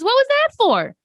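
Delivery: high, indignant joke voice. Retold or imitated. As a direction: squeak it out as the wronged man.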